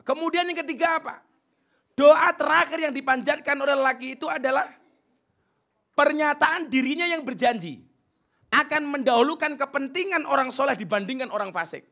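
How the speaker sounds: high, loud preacher's delivery; preaching loudly. Acted. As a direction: proclaim it to the whole hall. Preacher raising voice.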